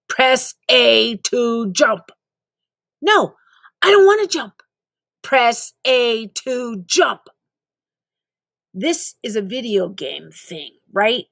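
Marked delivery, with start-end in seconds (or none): 0.1-2.1 slow mechanical game instructor; slow canned drill-sergeant prompt; robotic tutorial prompt. Acted. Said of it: "robotic game prompt". 3.0-4.6 exasperated refusal. Acted. 5.2-7.3 slow mechanical game instructor; slow canned drill-sergeant prompt; robotic tutorial prompt. Acted. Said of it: "robotic repeat".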